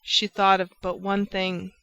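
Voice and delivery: ordinary delivery, nothing marked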